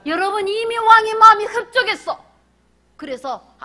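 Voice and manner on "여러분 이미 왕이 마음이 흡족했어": loud, high preaching burst; full-voiced pulpit emphasis; emphatic preaching. Real, not acted. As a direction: drive the point home. Preacher raises voice suddenly.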